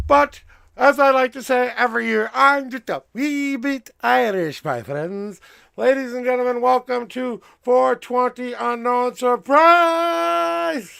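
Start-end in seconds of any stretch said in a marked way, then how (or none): 5.8-10.9 announcer intro. Acted. Host welcoming viewers to his stream, announcer style. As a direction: present the show grandly.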